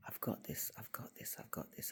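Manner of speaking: hushed, steadying herself under her breath; whispered self-reassurance. Retold or imitated. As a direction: whisper it like a private prayer. Whispered self-reassurance before God.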